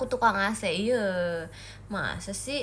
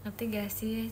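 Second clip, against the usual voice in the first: usual speaking voice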